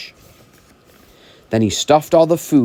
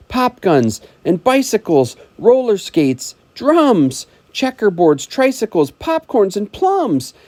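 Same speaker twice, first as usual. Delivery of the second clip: rising excitement; 11 semitones higher than usual. Acted. Reading the list of presents, building excitement. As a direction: pile up each present eagerly.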